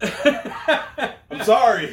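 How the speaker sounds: high, drawn-out apology through laughter; helpless laughing apology. Real, not acted. Co-host apologizing ahead of the segment, laughing loudly. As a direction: laugh through it, loud and stretched.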